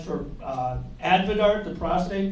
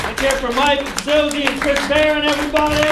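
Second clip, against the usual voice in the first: loud, raised stage shout; rallying the crowd; 7 semitones higher, 8 dB louder than usual. Real, not acted. Comic taking the stage after other acts, loud rallying call. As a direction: call for big applause over the cheering.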